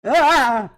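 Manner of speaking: sudden loud high yell; startling boo. Acted. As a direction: jump out and shout Ah. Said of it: "Did I startle you?".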